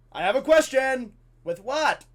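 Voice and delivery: loud, high blurted question; yelled classroom interruption; shouting pupil. Retold or imitated. Eager pupil interrupting, acted loud.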